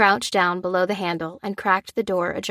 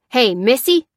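loud, raised scolding call; growled reprimand; 5 semitones higher, 6 dB louder than usual. A narrator's reading. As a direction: call out the daughter sternly. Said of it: "growled her father".

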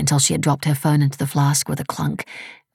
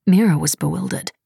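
usual speaking voice

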